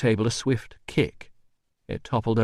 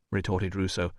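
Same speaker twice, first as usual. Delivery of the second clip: plain reading voice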